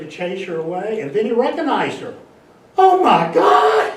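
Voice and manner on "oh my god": high, slow shout of recognition; mock shocked cry. Hawk spotting his returning mate, high shocked shout. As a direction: cry out like you just spotted your long-lost mate.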